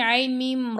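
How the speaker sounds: ordinary talk, nothing marked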